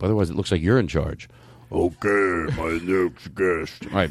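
deep slow radio host voice; low magnetic voice. Pretend. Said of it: "I'll have to deepen mine up".

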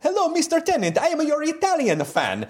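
high-pitched Italian fan reading a letter; hammed-up Italian accent, pitched well up; put-on Italian accent. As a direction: do broken English in an exaggerated Italian accent. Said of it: "I'm gonna do another accent".

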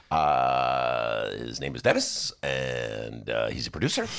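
lightly voiced Obama introducing a producer; Barack Obama impression. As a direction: drop into a measured Obama voice. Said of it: "that's my Barack Obama imitation".

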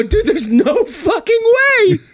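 very high-pitched mock disbelief; incredulous outburst. Friend doubting the diver to his buddy, high incredulous outburst. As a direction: protest in a squeal, half laughing.

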